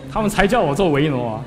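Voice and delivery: gleeful repeat. Retold or imitated. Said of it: "我乐得要命".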